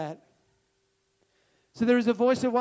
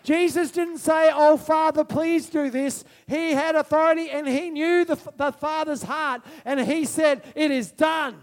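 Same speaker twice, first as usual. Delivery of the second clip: impassioned, raised preaching voice; loud preaching; 6 semitones higher, 6 dB louder than usual. Real, not acted. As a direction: declare it loudly to the congregation. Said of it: "sorry if I get loud".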